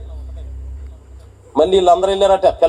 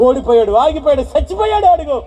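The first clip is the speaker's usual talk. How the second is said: triumphant gloating; 9 semitones higher than usual. Acted. Enemies shouting victory, acted.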